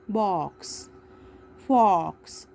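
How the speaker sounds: "बॉक्स", "फॉक्स" with a rounded aw vowel; open O sound. Shown as a demonstration. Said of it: "ओ का sound अ".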